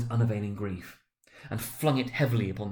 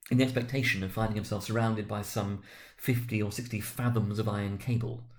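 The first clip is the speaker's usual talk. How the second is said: plain reading voice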